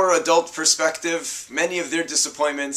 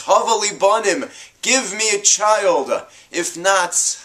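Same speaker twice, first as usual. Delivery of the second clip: higher, louder pleading demand; 7 semitones higher, 5 dB louder than usual. Acted. Rachel demanding a child from Yaakov, loud and desperate. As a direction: storm in and insist. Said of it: "storms into her husband Yaakov and demands".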